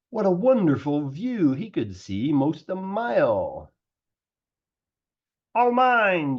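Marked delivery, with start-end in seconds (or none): 5.6-6.4 higher, louder gleeful cry; excited cry. A narrator's reading. Yertle atop nine turtles, crying out with glee. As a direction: crow it out in triumph. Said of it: "Yertle cried".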